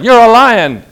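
bold declaration. Acted. Angel calling Gideon.

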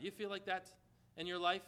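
ordinary talk, nothing marked

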